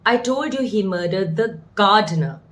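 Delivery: "gardener" set apart and emphasized at the end; stress on GARDENER. Shown as a demonstration. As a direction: hold back, then land firmly on "gardener". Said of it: "if we stress on the last word".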